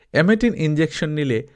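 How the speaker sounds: ordinary delivery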